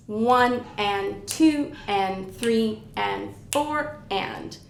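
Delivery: slow, evenly spaced beats, each word separate; even eighth-note count. Shown as a demonstration. Oboe teacher counting even eighth notes for students, slow and even. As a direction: count "one and two and" slowly and evenly. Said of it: "used to counting even eighth notes".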